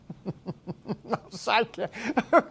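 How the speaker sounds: a helpless burst of laughter; real laugh. Real, not acted. Host cracks up reading an absurd headline, laughing. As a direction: laugh despite yourself, rising. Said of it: "Why am I laughing?".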